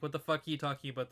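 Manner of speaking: rushed, insistent retelling; fast. Retold or imitated. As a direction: rattle it off quickly. Acting out insistent bystanders.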